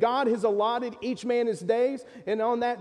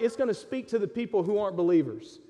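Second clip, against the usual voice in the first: normal talking voice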